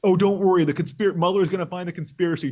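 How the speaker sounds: mock reassurance. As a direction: burst in loud and high, then ease slightly. Acts out Russiagate believers' assurances.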